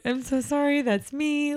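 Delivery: high-pitched retold owning-up; sheepish apology. Nanny owning up to the child's mother, sheepishly. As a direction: re-enact an embarrassed, apologetic confession.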